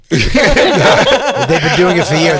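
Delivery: laughing. Real, not acted. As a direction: laugh while making the point. Laughing through exasperated point.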